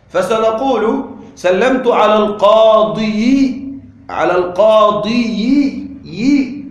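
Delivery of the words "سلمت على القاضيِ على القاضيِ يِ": slow القاضي with an added -yi, al-qāḍiyi; pronounced kasra. Shown as a demonstration. Teacher showing the heavy case ending, القاضي as al-qāḍiyi. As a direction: labour the extra "yi" closing القاضي. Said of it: "نريد كسرة أيضًا تحت الياء".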